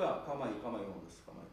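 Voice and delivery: calm soothing. Retold or imitated. Jesus calms storm.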